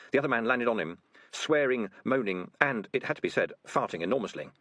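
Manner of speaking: usual speaking voice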